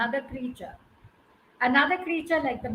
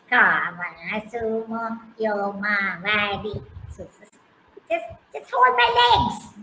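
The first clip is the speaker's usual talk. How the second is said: lightly voiced frog; 5 semitones lower, 3 dB louder than usual. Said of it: "He says".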